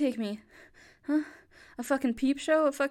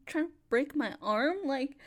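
ordinary talk

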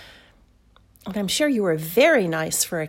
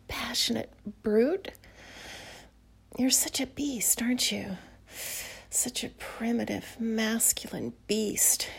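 sultry whisper; 4 dB quieter than usual. Acted. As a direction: whisper close and seductive. Whispers intimately throughout.

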